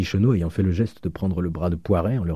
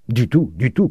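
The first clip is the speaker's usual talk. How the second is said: raised, emphatic refusal; louder, higher shouted protest; crowd crying out; 5 semitones higher, 5 dB louder than usual. A narrator's reading. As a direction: cry out as an indignant crowd. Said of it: "crièrent les pensionnaires".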